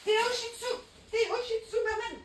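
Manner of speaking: high coaxing call to the little dog. Real, not acted. Calls dog that grabbed tissue paper. (appelle son chien Théo d'une voix très aiguë et câline)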